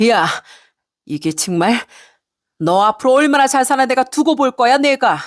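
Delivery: outraged threat rising to a high pitch; angry yelling. Acted. Mother-in-law threatens daughter-in-law on the phone, yelling angrily. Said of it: "그렇게 고래고래 소리치는 시모의".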